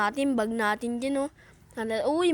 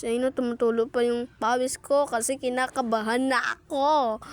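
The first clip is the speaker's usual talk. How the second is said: ordinary talk, nothing marked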